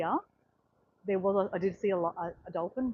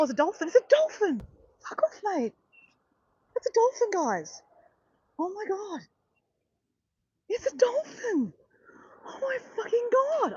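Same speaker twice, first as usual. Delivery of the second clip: high-pitched excited squealing; thrilled shouts at a surprise dolphin; adrenaline rush; 9 semitones higher than usual. Real, not acted. Sudden dolphin sighting at sea.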